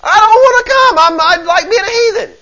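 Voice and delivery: loud, very high mock wail; whining tantrum. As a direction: wail like a stubborn child being dragged off. Acts out the heathen's louder refusal.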